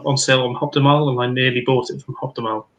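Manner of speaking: ordinary talk, nothing marked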